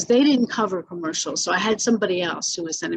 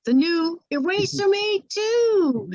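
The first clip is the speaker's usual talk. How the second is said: higher, slow pitch-up take; high ad read; 11 semitones higher, slower than usual. Shown as a demonstration. Redoing the commercial line for the director, high and slow. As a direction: sell the pen with a lifted voice. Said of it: "put emphasis on that word".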